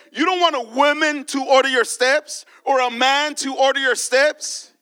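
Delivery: impassioned preacher calling out; preaching. Acted. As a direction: preach it to the church with fire. Preacher addressing congregation.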